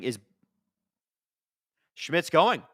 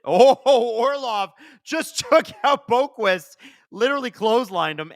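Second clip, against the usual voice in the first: high, loud, slow laugh-through of a big hit; cracking up in disbelief, much higher and louder; laughing excitedly; 7 semitones higher, 6 dB louder than usual. Real, not acted. Fan watching a hit on Boqvist, laughing loudly.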